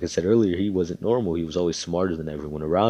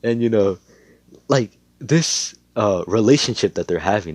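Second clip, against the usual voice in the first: ordinary delivery, nothing marked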